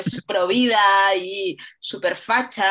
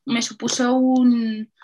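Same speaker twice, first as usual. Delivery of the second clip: drawn out through a yawn; sleepy, slow yawning words; 3 semitones higher, 4 dB louder, slower than usual. Real, not acted. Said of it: "qué sueño tengo".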